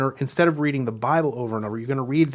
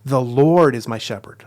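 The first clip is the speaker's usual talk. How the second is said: "Lord" stretched long and loud; stress on Lord; 7 dB louder than usual. Shown as a demonstration. Teacher demonstrating emphases in prayer, stress on "Lord". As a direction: punch and draw out the word "Lord". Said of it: "you read it over with different emphases".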